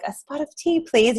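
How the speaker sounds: light mock British accent, high on the first words; a hint of posh British, playfully imitated. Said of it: "with a British accent".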